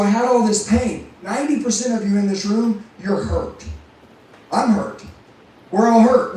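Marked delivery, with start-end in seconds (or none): 5.7-6.4 louder, driving the point home; emphatic call-out. Real, not acted. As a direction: punch it out to the room. Speaker rallying the audience.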